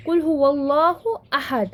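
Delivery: "अहद" begun with its own clear "a"; hamza pronounced. Shown as a demonstration. Teacher citing an example, hamza of अहद voiced. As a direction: recite कुल हुवल्लाहु अहद, sounding the alif of अहद. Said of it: "हम इस अलिफ को pronounce कर रहे हैं".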